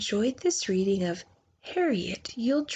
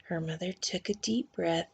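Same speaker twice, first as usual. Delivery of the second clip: usual speaking voice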